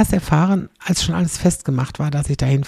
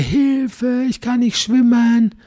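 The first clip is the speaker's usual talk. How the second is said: much higher, louder, strained Hilfe cries; mock drowning cries; 8 semitones higher, 4 dB louder, much slower than usual. Son imitating a drowning man screaming, high and loud. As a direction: wail like a man going under.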